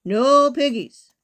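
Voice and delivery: loud, slow, stretched "no"; emphatic and drawn out; firm refusal. A narrator's reading. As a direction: shut the request down flatly.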